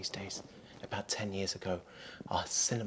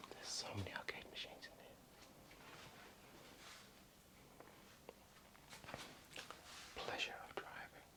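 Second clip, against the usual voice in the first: hushed whisper, sneaking around; breathy secret whisper; 13 dB quieter than usual. Real, not acted.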